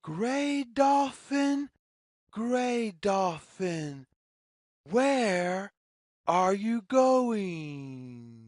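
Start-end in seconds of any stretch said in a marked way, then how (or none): none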